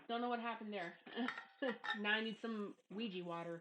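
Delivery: normal talking voice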